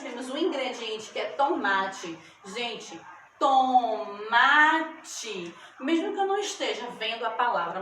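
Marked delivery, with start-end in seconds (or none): 3.4-5.5 to-ma-te in three long, separate beats; split into syllables. Shown as a demonstration. Teacher showing three syllables in tomate, stretched out. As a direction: sound out tomate slowly, syllable by syllable. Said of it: "Eu abro minha boca três vezes pra pronunciar".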